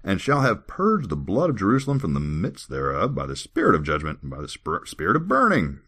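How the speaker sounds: scripture reading. Acted. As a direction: declaim it with big pitch swoops. Reads scripture aloud.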